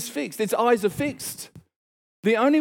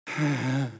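sigh of relief; 7 semitones lower, 5 dB quieter than usual. Retold or imitated. Finally seated on the plane.

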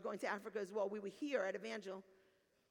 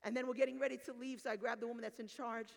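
ordinary delivery

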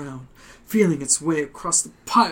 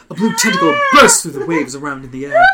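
ordinary talk, nothing marked

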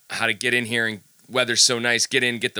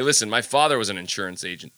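ordinary talk

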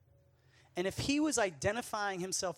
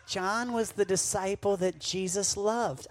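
teacher, slowly; 3 semitones higher, 3 dB louder, slower than usual. Retold or imitated. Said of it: "She goes".

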